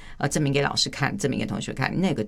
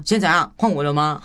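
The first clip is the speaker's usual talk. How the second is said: louder, sharp and confrontational; impatient snap; 3 semitones higher, 6 dB louder than usual. Shown as a demonstration. Wife showing the wrong way to demand her turn in a fight, louder and sharp. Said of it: "我不会说".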